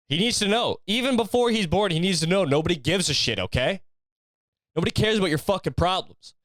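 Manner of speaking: high, pushed tough-love motivator rant; David Goggins pep talk. Pretend. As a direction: drill it into the unborn baby like a hardcore coach. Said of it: "playing fucking David Goggins audio".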